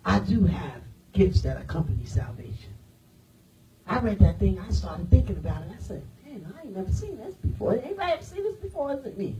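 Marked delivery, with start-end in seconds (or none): 0.1-2.7 hushed aside, almost breathless; whisper. Real, not acted. Aside under his breath. 6.2-9.4 softer, retold private amazement; mild hushed wonder. Retelling his own private wonder.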